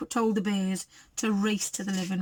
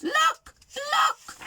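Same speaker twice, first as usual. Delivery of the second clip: very high squealing little bear; wee little voice. A narrator's reading. Baby Bear squeals in the kitchen, wee little voice. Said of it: "he cried in his wee little voice".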